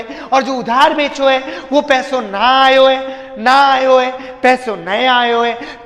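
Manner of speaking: slow, teasing village Hindi to the wife, lightly put on; mock rustic Rajasthani dialect. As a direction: playfully drawl the dialect words. (घरवाली को ग्रामीण बोली में छेड़ते हुए, धीमा और हल्का अंदाज़)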